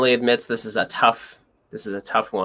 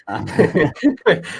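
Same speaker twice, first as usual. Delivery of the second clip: ordinary delivery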